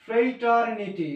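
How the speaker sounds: ফ্রেটারনিটি drawn out slowly, first vowel a long "frei" glide; demonstrated pronunciation. Shown as a demonstration. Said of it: "এটা ফ্রেটারনিটি". (ফ্রেটারনিটি ধীরে, "ফ্রেই-টার-নি-টি" উচ্চারণে)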